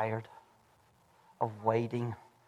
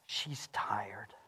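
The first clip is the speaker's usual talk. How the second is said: whispered; 6 semitones lower, 15 dB quieter than usual. Shown as a demonstration. Sermon, repeated point.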